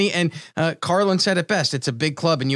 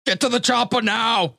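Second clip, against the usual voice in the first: slow, higher Arnold Schwarzenegger voice; Arnold impression; 6 semitones higher than usual. Shown as a demonstration. Host doing his Arnold for his cohost, slow and heavy. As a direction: bark it like an action hero. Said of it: "you do your best Arnold impression".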